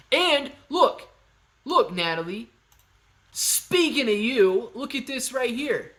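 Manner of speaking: high, loud and drawn out, bursting to show her; eager excitement. Real, not acted. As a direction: call her over, thrilled. Eagerly showing Natalie something.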